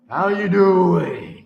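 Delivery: loud, very slow taunting greeting; loud, lingering mock hello; drawn-out greeting. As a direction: stretch it out loudly as a taunt. Taunting greeting to game character.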